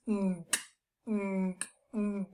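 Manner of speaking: quiet closed-mouth mm hums; soft nasal hum. Shown as a demonstration. Teacher demonstrating a soft nasal hum. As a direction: hum softly through the nose. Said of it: "Or it's going to sound like this".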